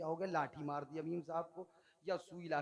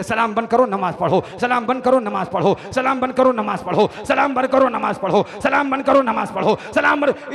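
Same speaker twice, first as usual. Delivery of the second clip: loud orders in a hammering singsong loop; insistent repeated shouting; brainwashing repetition; 4 semitones higher, 22 dB louder than usual. Acted. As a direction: drum the commands in relentlessly. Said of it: "इतनी बार कहा कि brain wash कर दिया".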